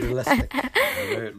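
squeaky high-pitched mock; high mock echo. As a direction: echo it in a silly falsetto. Mocks word in high voice.

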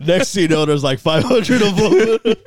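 cracking up at five hundred of them; laughing. Real, not acted. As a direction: laugh it out at the punchline. Laughing at the joke.

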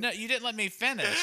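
laughing while protesting. Real, not acted. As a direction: laugh through the words. Laughing protest after being mocked.

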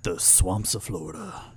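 low, slow, hushed spooky whisper; spooky late-night radio host. Pretend. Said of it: "real chill and real quiet and real spooky".

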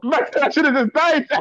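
high, loud, laughing through the words. Real, not acted. Storyteller to friends about his dread, laughing. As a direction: crack up while saying it. Joking over his own misfortune.

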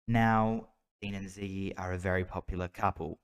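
ordinary talk, nothing marked

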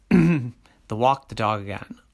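ordinary delivery, nothing marked

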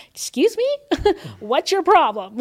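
high, loud, mock-confrontational challenge; battle mode. Woman retelling how she confronted those talking about her, battle-ready. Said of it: "in my ready battle mode".

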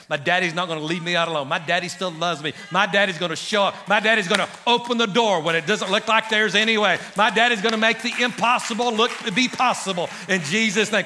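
rising from firm to loud proclamation; bold declaration building. Pretend. Pastor rallying his congregation, building to loud. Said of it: "a boldness to say to the world around you".

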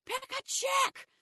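loud, high, slow shout. Retold or imitated. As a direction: yell it across the room, high. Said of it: "she goes".

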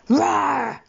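bear roar. Acted. Bears roar at Goldilocks.

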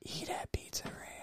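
laughing fake ad whisper; sneaky whispered ad plug.

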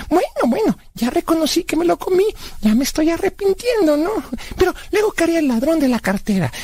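put-on high-pitched kid character hurrying on; high cartoonish excuse-making; childlike Blas, brushing it off.

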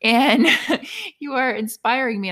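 high, loud, laughing praise; gushing proud. Retold or imitated. Mother gushing with pride to her little daughter, high and laughing. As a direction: gush with laughter over a proud moment. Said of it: "all I could do was be like".

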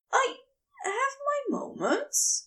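a shy, high admission; Aziraphale voice. A narrator's reading. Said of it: "Aziraphale admits".

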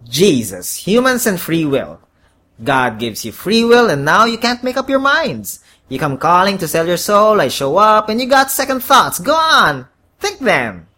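exasperated horned devil. A narrator's reading. Devil berates the hesitant man over free will, exasperated. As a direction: rail at indecisive humans.

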